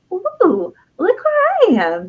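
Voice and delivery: put-on high, delighted little-girl voice. Happy inner child in her big chair, delighted. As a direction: marvel like a child on a throne. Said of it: "She was so happy".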